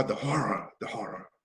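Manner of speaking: low, fading whisper of horror; soft, low, fading away; hushed dying words. Retold or imitated. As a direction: let it sink to nothing. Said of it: "his last words are".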